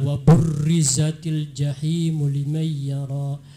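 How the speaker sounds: burrizatil with a heavy rolled R; slow chant, thick trilled RR in burrizatil. Shown as a demonstration. Judge copying the child's reading, heavy R in burrizatil. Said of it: "Tadi dibaca tebal".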